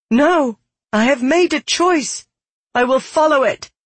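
high, loud, determined; defiant refusal. Acted. Girl refuses Milaka's advice to flee, defiant.

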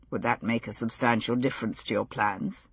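low, soft and dry; lightly voiced wry gentleman. A narrator's reading. Lord John, low and soft.

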